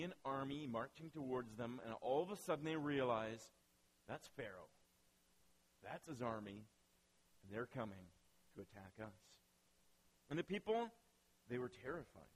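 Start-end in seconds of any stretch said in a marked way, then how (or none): 4.1-9.4 low, hushed realisation; hushed dread. Acted. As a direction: voice the dawning dread quietly and low. Israelites realise the army is coming.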